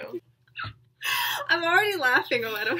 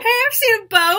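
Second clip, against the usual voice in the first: high, loud, eager voice; hammed-up, bubbly movie-scene reply; chirpy cartoon fish; 9 semitones higher, 5 dB louder than usual. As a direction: pipe up like an excitable cartoon helper. Acting out animated film scene.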